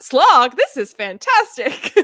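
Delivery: loud and slow, thrilled; delighted surprise. Retold or imitated. Rereader thrilled by the supposed slog, loud delight. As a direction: exclaim it with delight. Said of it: "I was like".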